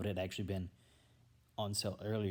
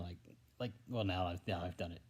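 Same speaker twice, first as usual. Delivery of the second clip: real stumble, repeating words, trailing softer; stuttering; 4 semitones lower, 3 dB quieter than usual. Real, not acted. Vlogger alone catching himself tripping up, stuttering. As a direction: trip over the words and restart. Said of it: "I'm stuttering".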